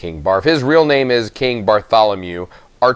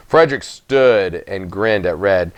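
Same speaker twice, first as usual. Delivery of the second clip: plain reading voice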